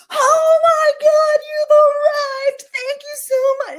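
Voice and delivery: hammed-up gushing host; way too much. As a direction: overdo the delight at a dinner guest. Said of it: "It's, like, too much".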